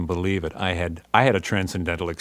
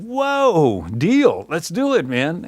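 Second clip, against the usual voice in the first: much higher, louder whoop of a deal; thrilled yes; 9 semitones higher, 4 dB louder, much slower than usual. Retold or imitated. Band accepting the record deal, whooping higher and louder. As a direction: jump at the deal. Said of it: "We said".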